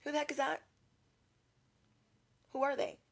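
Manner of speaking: high, soft nosy aside; mock-baffled murmur behind someone's back; hushed onlooker. Post office customers asking about a stranger, hushed.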